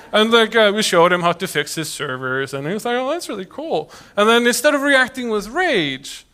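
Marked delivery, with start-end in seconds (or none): 3.0-3.9 higher, softer impressed voice; quietly marvel, as if imitating an admirer; a lighter retold delight; delighted, understated. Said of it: "was delighted to see us".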